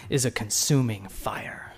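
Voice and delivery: hushed, reverent whisper; urging awe before God, in a whisper; awed whisper. Real, not acted. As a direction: breathe it out in awe. Awe at God's consuming fire.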